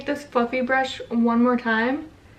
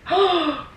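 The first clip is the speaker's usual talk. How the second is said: sharp gasp; 7 semitones higher than usual. Real, not acted. Sees a mistake on camera.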